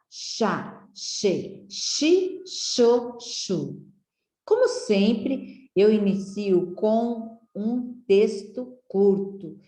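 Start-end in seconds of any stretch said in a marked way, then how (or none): 0.1-3.8 each syllable said slowly and separately: chá, che, chi, cho, chu; syllables sounded out. Shown as a demonstration. Teacher sounding out syllables for children, slow and separate. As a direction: sound out the syllables one by one for the class. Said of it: "Vamos lá?".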